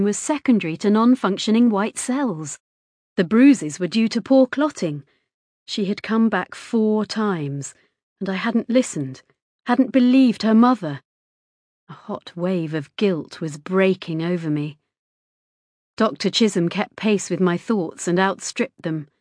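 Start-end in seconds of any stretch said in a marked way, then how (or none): none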